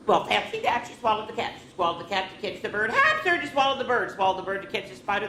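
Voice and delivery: lively storybook read-aloud; reading to children. Acted. Teacher reading a picture book to children, lively. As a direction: read it out to the kids with bounce.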